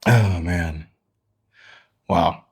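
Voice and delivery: panting after exertion; breathless gasping exhale; out of breath. Real, not acted. Panting after vocal exercise round.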